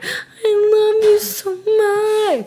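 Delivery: high, slow, stretched mock sob; retold crying. Holding her dog while crying over a movie, mock sob. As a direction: wail it like a teary owner. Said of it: "'cause I'm bawling, crying".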